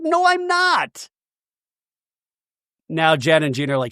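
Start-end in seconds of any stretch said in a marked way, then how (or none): 0.0-1.1 mock denial. [name] denying in the fight.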